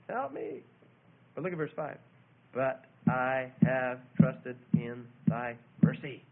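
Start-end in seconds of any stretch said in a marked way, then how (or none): 0.1-0.6 small high-pitched mock blubber; blubbering plea. David helpless before God, lightly acted blubbering. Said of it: "Soul blubbering before God". 2.5-6.2 very slow, low and firm; measured trust. Acted. David turning to faith in God, slow and deliberate.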